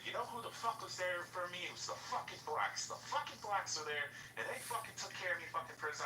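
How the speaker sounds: high-pitched retold rant. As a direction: imitate a man on a tirade. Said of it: "went on a whole fucking rant".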